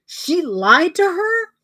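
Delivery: high, slow, stunned question; imitating him, drag it out in disbelief; shocked disbelief. Boyfriend shocked by plot twist.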